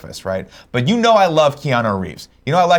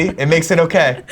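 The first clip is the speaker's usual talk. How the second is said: laughing; 6 semitones higher, 4 dB louder than usual. Real, not acted. Laughing, repeating Keanu's line.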